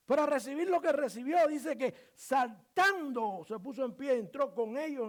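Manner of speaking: impassioned, high and loud sermon delivery; full-force preaching. Real, not acted. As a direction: drive each phrase home to the congregation.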